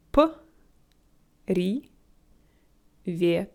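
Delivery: привет as П, ри, вет with long pauses; broken into parts. Shown as a demonstration. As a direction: sound out the first letter of привет alone, then each syllable. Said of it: "say this single letter at the beginning".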